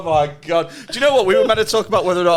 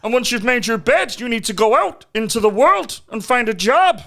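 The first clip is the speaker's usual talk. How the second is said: raised lilting voice sliding into Irish; Jordan Peterson; 5 semitones higher than usual. Retold or imitated. Lecturing as the psychologist, accidentally Irish impression. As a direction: preach with an accidental Irish lilt. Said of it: "Why is he Irish?".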